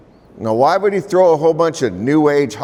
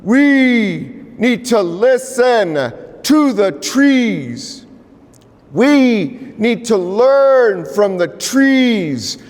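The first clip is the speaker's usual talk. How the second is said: high, slow solemn elder voice; elder's voice; 8 semitones higher, much slower than usual. Retold or imitated. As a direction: intone slowly like a revered elder. Elder's words, later repeated plainly.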